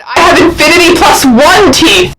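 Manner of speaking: huge, high, full-blast yell; over-the-top boastful shout. Retold or imitated. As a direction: bellow it to outdo the other boast. Topping a boast in a quoted exchange.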